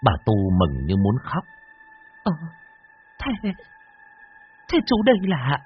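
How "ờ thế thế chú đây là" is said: hopeful stammer. Acted. Bà Tu stammering in hope.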